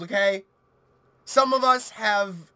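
ordinary talk, nothing marked

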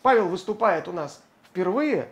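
usual speaking voice

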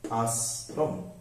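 আশ্রম drawn out slowly, স্রো sound clear; slow pronunciation demo. Shown as a demonstration. Teacher slowly sounding আশ্রম for students. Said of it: "রফলা থাকলে ওকার দিয়ে".

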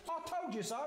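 high-pitched I-told-you-so; smug mouse. Acted. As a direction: crow it as the tiny mouse. Mouse shows off to Gruffalo.

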